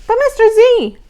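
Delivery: high, loud whine; whined. Acted. Abigail whining to Mister Z, high and loud. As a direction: whine a protest. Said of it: "she whined".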